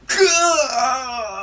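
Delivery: sudden high, loud pained yelp; a stab to the heart, cry out; Sanji's heartbreak, a shrill pained yelp. Retold or imitated. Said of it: "He's like".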